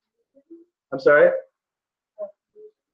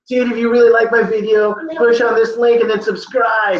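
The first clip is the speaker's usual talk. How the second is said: YouTuber plug; 5 semitones higher than usual. Retold or imitated. Teacher voicing a YouTuber.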